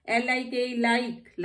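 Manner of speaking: ordinary talk, nothing marked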